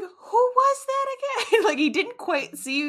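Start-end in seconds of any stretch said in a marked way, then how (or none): 0.0-1.6 mock bewilderment; puzzled boyfriend. Imitates boyfriend squinting, puzzled.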